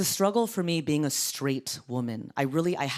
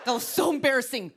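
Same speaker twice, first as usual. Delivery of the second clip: high, fast mock scolding; scolding mom; 9 semitones higher, faster than usual. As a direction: berate like an embarrassed mom. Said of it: "like a mom whose kid got lost".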